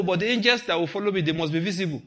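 ordinary talk, nothing marked

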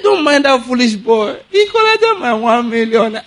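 casual. Retold or imitated. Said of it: "the man just said it casually".

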